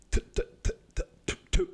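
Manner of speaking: voiceless, staccato stuttering noises; tongue-tied stammering. Retold or imitated. Addict comes out and can't talk, mimicked stutter. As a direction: imitate being unable to talk, just tiny sputters. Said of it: "you can't even fucking talk".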